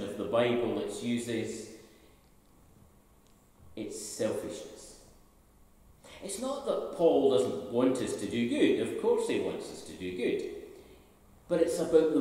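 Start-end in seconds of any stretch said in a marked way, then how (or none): none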